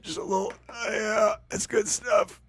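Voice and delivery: hammed-up blissed out on inhaler; mock high. [name] after using his inhaler, playing it up as high. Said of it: "I'm so high right now".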